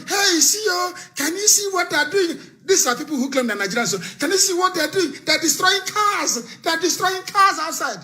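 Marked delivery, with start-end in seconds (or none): 0.1-2.4 mock panicked cry. Mocking people filming from room. 4.2-8.0 mock panicked cry. Mocking people filming from room.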